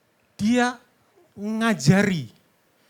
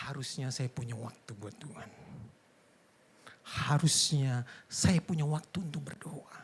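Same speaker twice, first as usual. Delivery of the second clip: hushed, low self-reproach; quiet regret; 3 semitones lower, 6 dB quieter than usual. Acted. Believer repenting of no time for God, hushed regret. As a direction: murmur it like a private confession.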